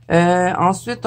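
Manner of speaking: ordinary talk, nothing marked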